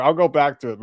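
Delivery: usual speaking voice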